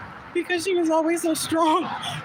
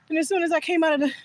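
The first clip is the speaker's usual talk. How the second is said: usual speaking voice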